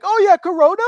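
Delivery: high, loud mock-childish enthusiasm; eager kids. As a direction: blurt it like an excited kid. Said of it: "And we'd go like".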